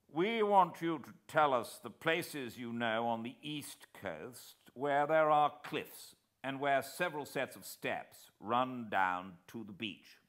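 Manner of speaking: a brisk cabinet minister cross-examining; War Minister. A narrator's reading. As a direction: statesman pressing for answers.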